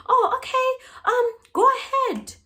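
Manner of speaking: mock sweet reply. To the man leaving for the garage, a high fake-sweet okay. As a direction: play it falsely agreeable, high and sweet. Narrator fakes agreement to the man.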